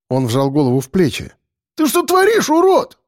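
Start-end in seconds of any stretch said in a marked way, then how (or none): 1.7-3.0 very high, indignant squawk; shrieked protest; outraged yell. Acted. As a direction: cry out as if just slapped. Outraged boy just slapped.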